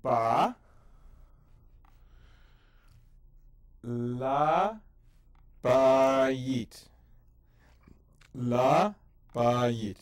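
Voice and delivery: loud sounding out Ba, La, Bayit with pauses; syllable by syllable. Shown as a demonstration. Teacher sounds out Bayit syllable by syllable, loud and slow. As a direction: break Bayit into syllables aloud. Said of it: "Three syllables".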